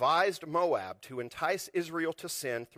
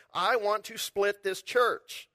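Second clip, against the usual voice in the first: mock troublemaker; 5 semitones higher, 4 dB louder, slower than usual. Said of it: "walk in with a banner saying".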